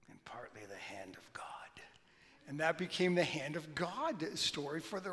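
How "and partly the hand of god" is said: a lower, softer whispered aside; hushed, mischievous confession; sly whisper. Retold or imitated. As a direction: let the secret slip out under your breath. Maradona's sly interview answer.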